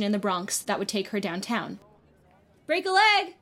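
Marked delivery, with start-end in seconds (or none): 2.7-3.3 shouted. A narrator's reading. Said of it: "Sophie shouted across the turnstiles".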